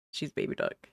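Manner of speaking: soft whisper, no voice. Real, not acted. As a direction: breathe the name in a whisper. Introducing the toy duck again.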